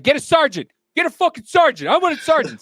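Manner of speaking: high, loud mock demand; angry traveler demanding. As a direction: bark for a sergeant like he's fed up. Angry man demanding sergeant.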